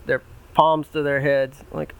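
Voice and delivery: plain reading voice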